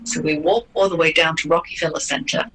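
ordinary talk

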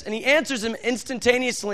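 normal talking voice

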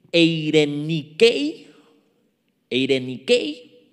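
eirenikei, lento y fuerte, con acento en la E; demostración de pronunciación. Shown as a demonstration. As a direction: pronuncia eirenikei despacio, marcando la E y la K. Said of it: "con acento en la E y con K". (eirenikei pronunciado lento, con acento en la E)

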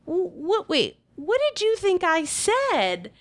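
high, stammering start, then drawn-out question; bewildered. Retold or imitated. Wife realizing her friend misheard, bewildered. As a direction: halt them in high-pitched confusion.

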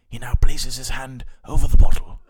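lightly done nature documentary narrator; hushed, loud mock nature commentary; David Attenborough. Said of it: "a quick David Attenborough rundown".

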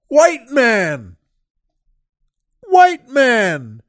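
high, loud imitation of a delighted stranger; happy shout. Retelling a big stranger hailing him, happy shout. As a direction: holler it out joyfully, mimicking him. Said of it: "he just shouted".

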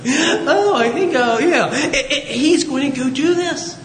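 high-pitched self-talk; Moses. Acted. Moses talking to himself on seeing the bush, high-pitched musing. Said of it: "Moses talks to himself".

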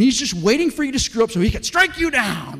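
hammed-up wrathful strike; mock thundering threat. Mocking vengeful view of God.

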